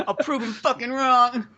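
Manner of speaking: high, slow vow through laughter; hammed-up high-pitched determination; mock defiant son. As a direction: play the wounded son swearing revenge.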